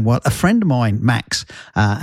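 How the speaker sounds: usual speaking voice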